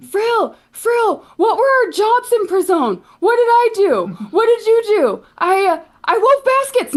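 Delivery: very high, very loud panicked shouting; yelling through a door. Acted. Dam yelling to Frill about prison jobs, frantic shout. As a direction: scream it through the keyhole, desperate. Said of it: "still yelling through the keyhole".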